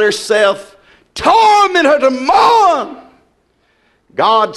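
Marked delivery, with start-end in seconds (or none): 1.1-3.0 preaching shout. Real, not acted. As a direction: drag it out as a shouted warning. Preacher repeats judgment line.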